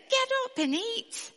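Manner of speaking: high, bright angel command. Acted. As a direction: call him awake from above. Angel wakes Elijah.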